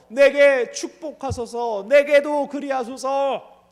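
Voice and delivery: loud, slow, crying plea; wailing plea. Acted. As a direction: sob out the plea. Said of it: "소리 높여 울며".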